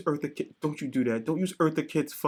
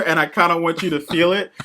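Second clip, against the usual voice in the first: usual speaking voice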